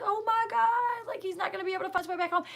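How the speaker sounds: high, panicked cry, then rushing fast; retold despair. Mother fears her toddler can't find home, panicking. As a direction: voice the dread spilling out. Retelling despair, kid missing.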